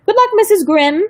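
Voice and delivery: mocking farewell. A narrator's reading. Caseworker leaving the girls with the stranger, high snide goodbye. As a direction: toss off a snide good luck.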